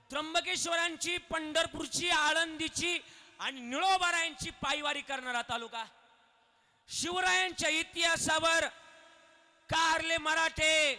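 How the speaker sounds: high, loud, slowing chant-like proclamation; full-force preaching. Acted. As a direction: belt each place name to the crowd.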